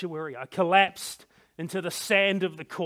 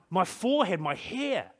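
ordinary delivery, nothing marked

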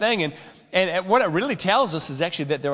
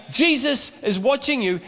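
high, squawky parrot call; a higher parrot voice calling across the room; 7 semitones higher than usual. Retold or imitated. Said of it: "as it came out again".

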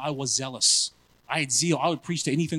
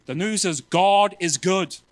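slow proclamation; 3 semitones higher, 5 dB louder, slower than usual. Retold or imitated. Retold preaching line.

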